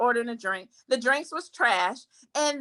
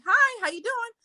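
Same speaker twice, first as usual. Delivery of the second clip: cheery greeting; 9 semitones higher than usual. Retold or imitated. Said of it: "It wasn't like".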